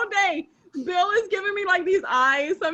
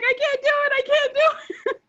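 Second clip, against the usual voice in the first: high, strained, half-laughing panic; panicked cry; 11 semitones higher than usual. Retold or imitated. Retelling her brick break, a high panicked half-laughing cry. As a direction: wail it like you're sure you'll fail. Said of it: "I'm like".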